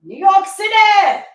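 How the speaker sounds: loud, drawn-out hype call; excited shout. Real, not acted. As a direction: call it out like a crowd-pleaser.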